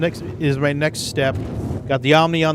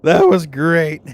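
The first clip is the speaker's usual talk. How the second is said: excited; 5 semitones higher, 4 dB louder, slower than usual. Real, not acted. Praising friend's joke.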